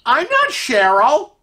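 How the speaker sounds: high, slow, questioning lift at the end; puzzled objection. Acted. Lady wrongly called Cheryl as he leaves, puzzled. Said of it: "And she goes".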